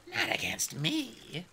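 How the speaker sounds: breathy high whisper of a threat; whispered dragon queen; menacing whisper. A narrator's reading. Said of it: "Scarlet menacing whisper".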